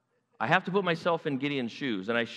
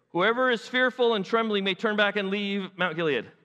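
higher-pitched proclamation to the troops; public announcement; 5 semitones higher, 3 dB louder than usual. Acted. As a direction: announce it formally to a crowd. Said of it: "clear your throat, and say".

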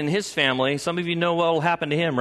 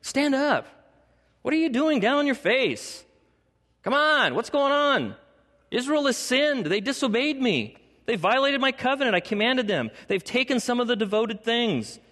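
high, urgent rebuke, pressing hard; a father shaking his son awake to the truth; God's voice; 8 semitones higher than usual. Retold or imitated. Preacher voicing God confronting Joshua, urgent and high. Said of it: "I'm inflecting his voice".

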